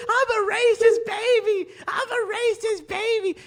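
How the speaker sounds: high, slow baby voice with mock crying. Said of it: "She's like".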